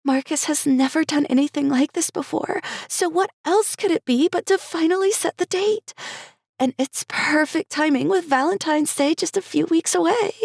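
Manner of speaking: very high, giddy; giddy bride-to-be; Cherry's voice. Acted.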